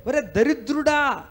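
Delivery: high, slow, sneering insult; a hammed-up jeer at a poor wretch; mock scolding curse. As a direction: spit it out like a bitter grumbler. Mocking a grumbler's self-cursing.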